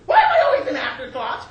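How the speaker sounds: high, loud complaint; shrieked accusation; wounded, outraged mother. Acted. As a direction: wail it like a slighted mother. Wounded mother argues with son.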